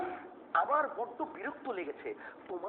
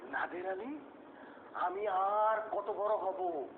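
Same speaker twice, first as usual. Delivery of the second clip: slow, louder, grieving recitation; emotional recital; 4 dB louder, slower than usual. Shown as a demonstration. কবি গভীর আবেগে কবিতা আবৃত্তি করে. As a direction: pour grief into each line. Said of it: "দুঃখ ভরাক্রান্ত মন নিয়ে".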